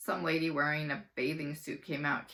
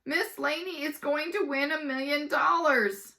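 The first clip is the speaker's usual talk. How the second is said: excited; 9 semitones higher, 6 dB louder than usual. Acted. Kids excited.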